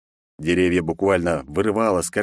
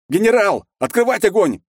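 high, loud shout over radio; 13 semitones higher, 5 dB louder than usual. A narrator's reading. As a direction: yell the order urgently. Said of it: "кричали из наушника".

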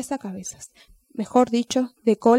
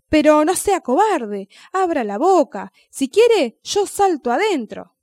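louder, higher, slow scolding yell; angry yelling; 5 semitones higher, 5 dB louder than usual. Acted. Angry snail yelling at the scared dog. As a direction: snap at the cowardly dog, loud and high. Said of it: "comenzó a gritarle al perro".

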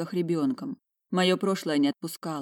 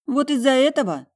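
higher, louder disbelieving question; incredulous reproach; 8 semitones higher, 6 dB louder than usual. A narrator's reading. Asking if rags caused the tears, incredulous.

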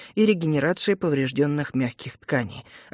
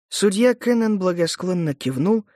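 ordinary delivery, nothing marked

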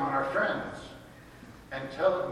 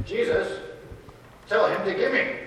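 higher, louder grabby plea to Jesus; whiny demand; 7 semitones higher, 5 dB louder than usual. Retold or imitated. Brother begs Jesus for his share, loud and higher. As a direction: nag Jesus like a greedy kid. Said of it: "he basically says".

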